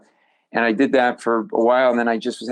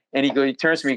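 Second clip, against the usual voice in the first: ordinary delivery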